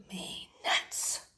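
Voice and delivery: slow whispered grumble to the listener; whispered aside. A narrator's reading. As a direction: confide it under your breath, slowly.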